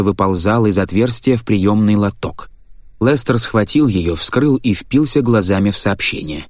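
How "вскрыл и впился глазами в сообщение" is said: ordinary delivery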